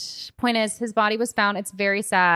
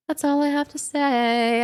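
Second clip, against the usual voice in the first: higher, slower prim voice; mock prim refusal to say more; coy sign-off; 7 semitones higher, slower than usual. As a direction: close the topic primly. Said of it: "closing a topic coyly".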